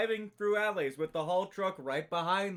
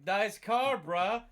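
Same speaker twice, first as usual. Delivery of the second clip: put-on Boston accent; 4 semitones higher, 5 dB louder, much slower than usual. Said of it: "You have to give it the Boston accent".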